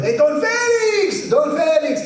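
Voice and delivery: high, loud, slow call across the yard. Retold or imitated. A neighbour on a bike calling to grandpa, loud and high. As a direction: holler his name from the road.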